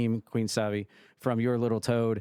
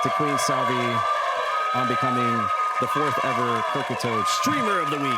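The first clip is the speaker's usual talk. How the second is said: booming host announcement; loud announcer call; 5 dB louder than usual. Acted. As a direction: proclaim it like an award show. Big announcement of winner.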